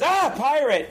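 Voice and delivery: high mock shriek; scared cry. Dean sees the pirate skeleton, high mock shriek. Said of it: "and goes".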